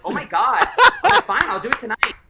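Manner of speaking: loud, high, laughing mock exasperation; exasperated retelling. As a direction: give in noisily, half laughing at the memory. Acted exasperation at family intervention.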